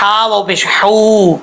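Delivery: slow spelling demonstration; thin h. Shown as a demonstration. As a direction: spell it out, then a long ہو with pharyngeal h. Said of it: "یہ بریک پڑھا جاتا ہے".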